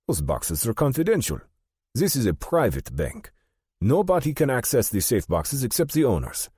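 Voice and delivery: put-on high-pitched, insistent German-accented banker; German-accented objection, pitched well up. A narrator's reading. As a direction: refuse firmly in a German accent. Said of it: "In a noticeable German accent".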